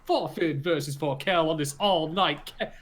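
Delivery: wrestling commentator. Pretend. Wrestling show opening announcement.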